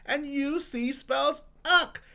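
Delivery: slow drill, each ending sound punched; teacher exaggerating syllables for parents; emphasized sounds. Shown as a demonstration. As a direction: stress every ending like a chant. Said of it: "I'm just emphasizing the sounds".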